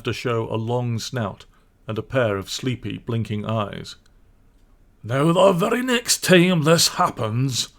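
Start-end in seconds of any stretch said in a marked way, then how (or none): 5.0-7.7 slow, loud and higher grumble at the door; a gruff voice, hammed up, slow and loud; gruff suspicious badger. Badger grumbles at visitors, gruff and suspicious. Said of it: "said a gruff and suspicious voice".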